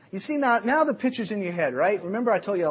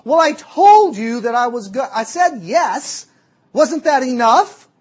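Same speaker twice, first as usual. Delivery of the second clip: much louder, higher, slowing down at the end; indignant shouting; 7 semitones higher, 14 dB louder, slower than usual. Retold or imitated. Girl scolding her date, loud and indignant. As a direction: spell it out in outrage. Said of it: "And she says".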